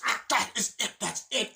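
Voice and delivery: high, loud exasperated outburst; breaking point. Retold or imitated. Man at breaking point with his roommate, exasperated shout. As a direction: snap loudly as patience runs out. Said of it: "it's like his breaking point".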